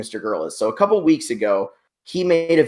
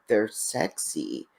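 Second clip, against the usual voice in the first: slow, soft, creepy drawn-out voice; 10 dB quieter, much slower than usual. Retold or imitated. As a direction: say it slowly like a creep, barely voiced. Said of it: "creepy voice".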